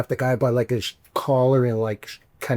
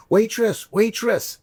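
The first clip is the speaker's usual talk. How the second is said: high-pitched mock call for service; imitated drunk calling out; 10 semitones higher, slower than usual. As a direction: mimic an obnoxious passenger hailing the waitress. Drunk passenger calling the stewardess.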